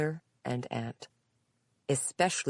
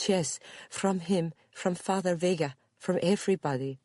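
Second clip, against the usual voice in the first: put-on higher Spanish-accented voice, agitated; 5 semitones higher than usual. A narrator's reading. Visitor names whom she fled, agitated Spanish accent. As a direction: list them anxiously in a Spanish accent.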